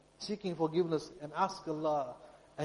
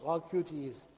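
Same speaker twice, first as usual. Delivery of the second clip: ordinary talk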